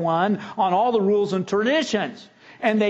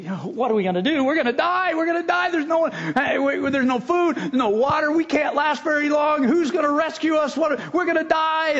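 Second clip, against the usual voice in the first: higher, mock-frantic shouting about dying; panicked castaway; 8 semitones higher than usual. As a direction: play the shipwrecked man losing it. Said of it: "the one guy gets all upset".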